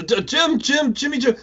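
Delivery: a hint of a panicked character calling Jim; quoting the character. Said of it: "so many people who quote that".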